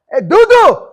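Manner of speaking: loud, high yell to a friend; imitating a street shout, full volume; screamed call. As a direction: holler it out really loud. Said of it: "screams out really loud, yells out".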